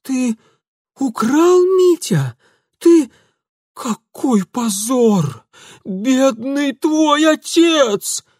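high, loud, slow wailing; trembling, lamenting aunt. Acted. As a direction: wail it in shame. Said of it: "дрожащим голосом".

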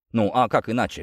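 ordinary delivery

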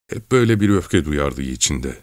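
ordinary delivery

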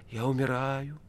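soft, failing last thought; fading whisper. A narrator's reading. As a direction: say it softly as if fainting. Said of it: "теряя сознание, подумал". (тихо, теряя сознание)

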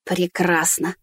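soft sarcastic grumble; grumbling. Acted. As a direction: grumble it with a frown. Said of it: "проворчала она, нахмурившись".

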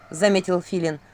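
usual speaking voice

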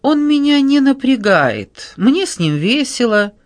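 breezy, high-pitched and unhurried; light, lively nonchalance; carefree. A narrator's reading. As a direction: say it as if nothing bothers you. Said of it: "беззаботно сказала она".

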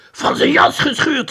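high, loud proud announcement; triumphant. A narrator's reading. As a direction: crow it in triumph. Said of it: "hielden triomfantelijk". (hoog, triomfantelijk geroep)

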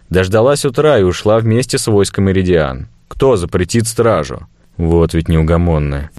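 plain reading voice

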